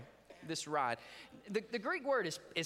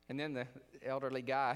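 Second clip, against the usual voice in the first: ordinary talk, nothing marked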